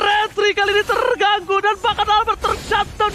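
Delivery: high, loud hype play-by-play; esports caster. Acted. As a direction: call the teamfight at full excitement. Hyped play-by-play at teamfight. (komentator esports heboh)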